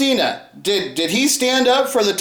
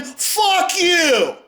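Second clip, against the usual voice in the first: higher, yelled curse; a raw, higher-pitched shouted curse; defiant shout; 7 semitones higher, much slower than usual. Real, not acted. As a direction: hurl it at them. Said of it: "And I'm here saying".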